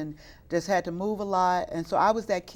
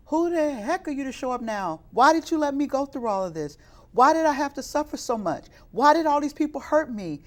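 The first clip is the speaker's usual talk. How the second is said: raised, louder, angry questions at God; replaying a furious outburst, higher and louder; retold cussing out; 7 semitones higher, 6 dB louder than usual. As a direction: hurl the why-questions upward, half reliving it. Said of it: "I literally cussed him out".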